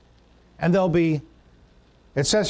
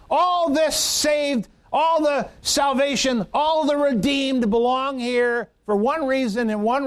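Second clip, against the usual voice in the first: mock crying out; 6 semitones higher than usual. Believers crying out together before the throne, exalted proclaiming. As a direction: lift the voice as if crying out in heaven. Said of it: "we'll cry out with a loud voice".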